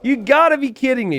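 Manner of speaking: high, loud exasperated cry; disbelief. Retold or imitated. Caster reliving his lost villager, high disbelieving cry. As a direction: wail it in disbelief. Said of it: "I was like".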